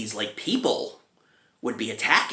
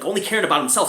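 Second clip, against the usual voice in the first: ordinary talk, nothing marked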